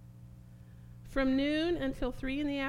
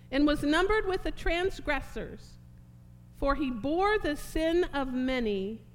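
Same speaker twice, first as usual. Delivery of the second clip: loud, declamatory preacher reading, easing at phrase ends; forceful scripture reading; 3 semitones higher, 4 dB louder than usual. Acted. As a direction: proclaim the verse to the congregation.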